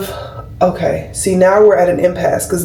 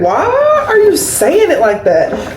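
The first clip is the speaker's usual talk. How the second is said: high, drawn-out exasperated question; retold indignant comeback, pitched up and slow; exasperated retort; 9 semitones higher, slower than usual. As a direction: stretch it out high in mock disbelief. Retells her exasperated reply to boyfriend.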